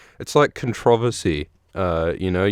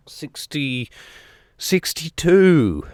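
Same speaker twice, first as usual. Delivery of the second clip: drawn-out announcement; 6 semitones higher, much slower than usual. Acted. Host announcing the episode number to viewers, slow and raised. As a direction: stretch sixty-two slowly up high, like a grand reveal.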